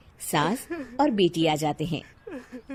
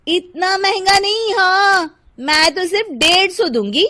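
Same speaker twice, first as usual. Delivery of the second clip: louder, higher haggling housewife; indignant Shilpa; 10 semitones higher, 9 dB louder than usual. Acted. Housewife refusing the vendor's price, loud and indignant. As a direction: protest the price loudly.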